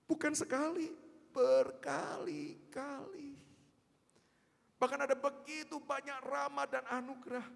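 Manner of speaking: high, loud pulpit preaching; full force preaching. Acted. Preacher urging the congregation to see through grace, loud fervent preaching. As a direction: drive grace home to the congregation.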